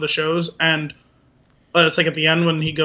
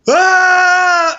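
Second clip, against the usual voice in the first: a sudden, much higher screamed "Ah!" from the band; band's sudden scream; 12 semitones higher, 7 dB louder than usual. Retold or imitated. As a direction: blurt a wild rock yell out of nowhere. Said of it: "And they just go".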